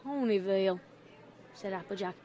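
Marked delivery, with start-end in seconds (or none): 0.0-0.8 low, guarded reply; wary answer. A narrator's reading. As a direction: answer low with narrowed suspicion. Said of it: "narrowing her eyes".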